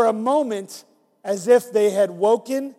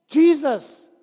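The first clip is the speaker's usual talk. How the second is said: higher, louder cry of joy; joyful recognition; 7 semitones higher, 6 dB louder than usual. Acted. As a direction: cry his name in joy. Mary recognizes Jesus.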